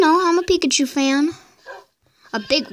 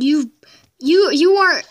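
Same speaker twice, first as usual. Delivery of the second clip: loud flustered stammer at the villager; sputtering anger; 3 semitones higher, 5 dB louder than usual. Pretend. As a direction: stammer out of outrage.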